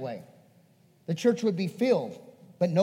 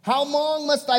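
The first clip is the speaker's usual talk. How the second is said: harsh rebuke by Jesus; 8 semitones higher, 6 dB louder than usual. Acted. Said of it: "it's harsh when I see it".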